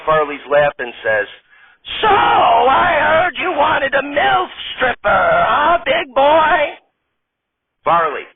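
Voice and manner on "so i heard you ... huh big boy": high, slow, loud flirting granny voice; high slow granny voice, loud and flirty. Acted. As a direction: tease him like a saucy old grandma. Old lady character voice.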